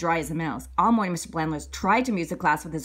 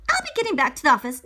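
quick, high principal; 6 semitones higher, 3 dB louder, faster than usual. Acted. Principal hurrying off.

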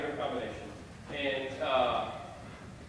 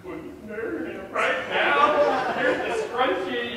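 higher, slow, swooning offer; hammed-up lovestruck boy; smitten gushing; 8 semitones higher, 7 dB louder, slower than usual. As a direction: melt like a lovesick kid. Smitten boy's inner reaction.

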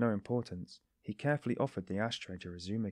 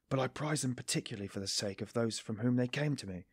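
ordinary delivery, nothing marked

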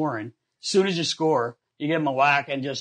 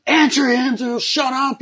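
much higher, louder teammates hollering; mock yelling; 11 semitones higher, 6 dB louder, slower than usual. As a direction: holler at her to answer. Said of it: "I hear the guys yelling".

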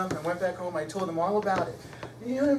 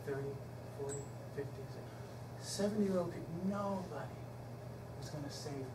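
normal talking voice

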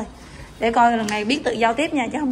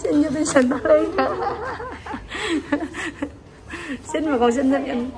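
talking through laughter; laughing; 4 semitones higher than usual. Real, not acted. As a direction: laugh while saying it. Mother laughing at the child.

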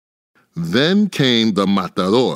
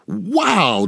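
high sudden wow, then down; surprised wow; 10 semitones higher than usual. A narrator's reading. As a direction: cry out at the sting. Bee stings Ferdinand.

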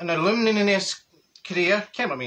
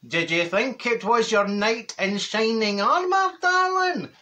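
slow, sing-song camp voice rising high on darling; hammed-up luvvie neighbour, drawn out; 4 semitones higher, slower than usual. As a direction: neighbour showing off his foil blanket, camp and slow. Neighbour quoted, fishing for praise.

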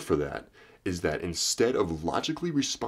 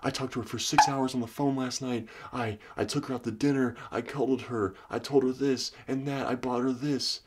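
raised, exasperated guy listing everything he did; frustrated boyfriend; 6 semitones higher than usual. Retold or imitated. Confused boyfriend venting about his girlfriend, raised exasperated voice. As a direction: voice the fed-up boyfriend pleading his case, pitched up. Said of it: "He's like".

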